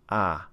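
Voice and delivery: "ah" as an open front vowel. Shown as a demonstration. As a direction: hold "ah" between cat and father. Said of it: "just below the little A E symbol".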